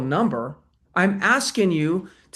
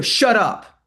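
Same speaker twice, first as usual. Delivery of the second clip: confrontational shut up; 4 semitones higher, 5 dB louder than usual. Shown as a demonstration. Coach demoing a hostile "Shut up" to the class, loud and sharp. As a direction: SHUT UP barked loud and higher. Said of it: "look at my facial expression. Look at the tone".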